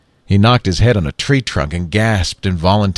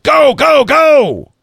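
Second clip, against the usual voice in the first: high, loud frantic shout; 6 dB louder, much slower than usual. A narrator's reading. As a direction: shout them off, go go go. Lee Daniel shouts them away.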